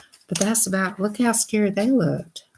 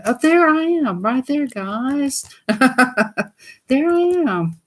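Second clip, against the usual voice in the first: much higher, teasing; joking high; 7 semitones higher than usual. Pretend. Kidding viewers that she's Humpty, high and playful. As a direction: joke that the egg is you. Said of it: "I'm just kidding".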